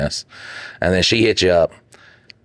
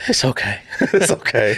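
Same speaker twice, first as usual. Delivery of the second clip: mock lady voice; 5 semitones higher than usual. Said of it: "mock repeat of lady".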